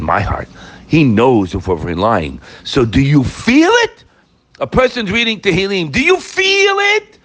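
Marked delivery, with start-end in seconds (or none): 5.9-7.2 high, drawn-out probing question; pointed challenge. Real, not acted. Preacher confronts listeners about whether they feel it, challenging.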